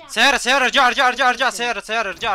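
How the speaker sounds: loud, high, urgent yelling; urgent warning shout. Real, not acted. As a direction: shout the warning to get back. Warning teammate of car.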